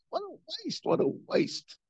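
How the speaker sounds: hushed, grieving "what a waste"; hushed lament. Real, not acted. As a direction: sigh it out, almost voiceless.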